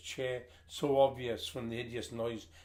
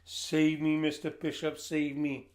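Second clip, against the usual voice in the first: louder, higher "Save me, Mister Bishop, save me" cried out; desperate plea; 4 semitones higher, 6 dB louder than usual. A narrator's reading. Stranded boy crying out to the approaching rescuer, loud and desperate. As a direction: beg him to come and save you.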